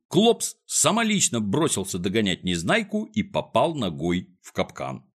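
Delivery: usual speaking voice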